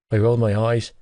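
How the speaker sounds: usual speaking voice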